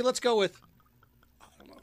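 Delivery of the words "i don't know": whisper. Real, not acted. Muttered aside while pouring.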